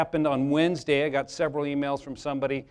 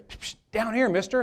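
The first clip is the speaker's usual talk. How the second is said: hissed psst, then raised pitch; frog calling out; 8 semitones higher than usual. Acted. Frog hailing Bob from down below, hissed call. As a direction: hiss for attention, then call up to him. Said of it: "All of a sudden he hears".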